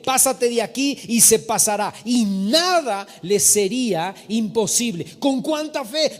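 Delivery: fervent pulpit proclamation; emphatic preaching. Real, not acted. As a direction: preach it forcefully, stretching each weighty phrase. Preaches at full force.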